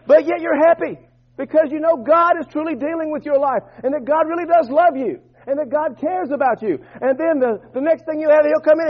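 high, sustained pulpit intonation; urgent preacher driving the point; impassioned preaching. Acted. Preaches at full force.

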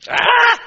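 short, explosive high-pitched cry; loud high yell. Acted. Preacher venting after a sarcastic rant, sudden high yell.

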